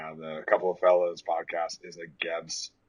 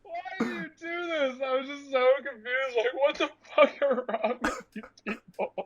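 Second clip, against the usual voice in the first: mock confused whine. Complaining to the friends, high baffled whine.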